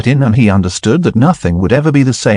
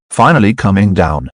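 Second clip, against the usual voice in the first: usual speaking voice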